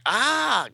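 short, high-pitched comic-book scream; acted yell. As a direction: cry out as the hero gets zapped. Character attacked in the comic.

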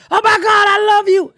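high, loud starstruck gush; gushing fan. Retold or imitated. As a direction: squeal it like an excited fan. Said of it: "She said".

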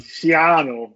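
put-on loud Jersey accent on Schiano. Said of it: "In his thick Jersey accent".

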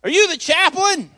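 high, loud call from a side room; retold yell. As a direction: call out like a stranger hailing you. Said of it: "somebody yells out of the side room".

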